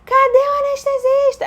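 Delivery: high, drawn-out yell for help; re-enacted desperate high scream; retold bellowing. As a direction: bellow it like a woman in labor. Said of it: "Eu fui berrando".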